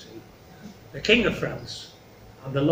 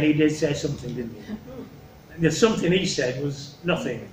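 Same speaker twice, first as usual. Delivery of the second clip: ordinary delivery, nothing marked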